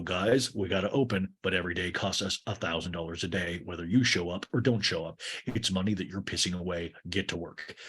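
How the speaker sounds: lightly voiced low, grumbling owner; a hint of a mean boss, kept low; Greg. Mean owner complains to Lisa, lightly voiced low grumble.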